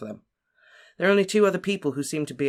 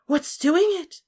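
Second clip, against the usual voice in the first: high, louder, panicked demand; terrified; 5 dB louder, slower than usual. A narrator's reading. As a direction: shriek it out in fright. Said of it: "demanded in a terrified voice".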